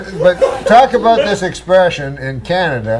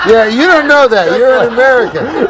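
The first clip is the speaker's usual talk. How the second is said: teasing through laughter, a bit higher; laughing; 6 semitones higher than usual. Real, not acted. Canadian host laughing while ribbing his American cohost. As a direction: chuckle out the jab at the American.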